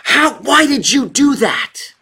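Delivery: put-on a parent scolding a toddler, high and loud. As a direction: snap at the kid over spilt milk. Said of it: "you turn around to that kid and you say".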